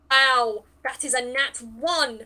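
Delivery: loud, exasperated at the roll; loud dismay. Real, not acted. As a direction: groan at the bad roll out loud. Rolled a natural one.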